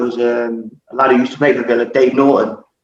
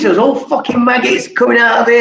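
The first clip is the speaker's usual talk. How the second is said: raised, high, half-shouted disgust; disgusted exclamation; 8 semitones higher than usual. Retold or imitated. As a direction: hit the punchline with horror. Climax of the maggot prank story.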